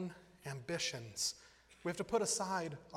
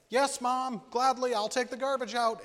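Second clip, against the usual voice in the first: mock eager child; 9 semitones higher, 9 dB louder than usual. Child's fake eager reply.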